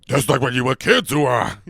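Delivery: loud gung-ho soldier voice; hammed-up Pacino dad. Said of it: "He plays their dad".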